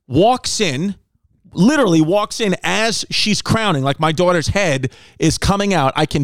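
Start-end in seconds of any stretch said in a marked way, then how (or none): none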